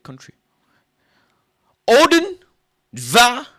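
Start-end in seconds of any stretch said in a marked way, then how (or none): 1.9-3.5 high loud barked count; mock Russian counting. Comic counting in Russian, loud barks.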